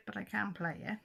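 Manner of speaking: ordinary talk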